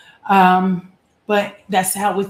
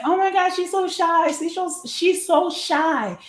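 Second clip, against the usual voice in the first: high cooing, slowing on shy; high gushing voice about a shy girl; gushing adults; 11 semitones higher than usual. Retold or imitated. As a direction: fuss over the shy child. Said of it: "they would always say".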